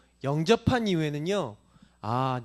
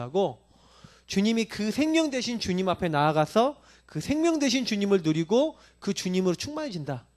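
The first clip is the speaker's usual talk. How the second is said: normal talking voice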